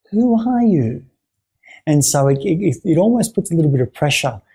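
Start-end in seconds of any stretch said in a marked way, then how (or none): 0.1-1.1 high, searching question with strong emphasis; emphatic curiosity. Shown as a demonstration. Therapist putting gentle pressure on a client, intensely curious. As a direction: lift the pitch high, really trying to find out. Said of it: "there's this curiosity".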